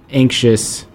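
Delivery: normal talking voice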